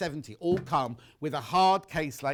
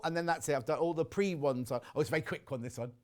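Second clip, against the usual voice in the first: usual speaking voice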